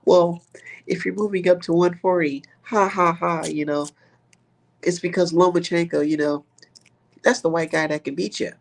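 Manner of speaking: high, laughing mock of a smug pundit; mocking Paulie Malignaggi. Streamer mocking a boxing pundit, high giggly mimicry. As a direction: ham up a giggly, smug know-it-all voice. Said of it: "He says".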